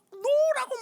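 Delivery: very high, loud angry shout. Retold or imitated. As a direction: snap at her to let go. Son shouting at mother. (높고 크게 화내며 외침)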